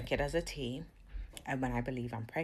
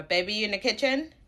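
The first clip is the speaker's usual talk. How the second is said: louder, higher call to the kitchen; 9 semitones higher, 11 dB louder than usual. Real, not acted. Checking if her partner is in the kitchen, loud call. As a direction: call across the house.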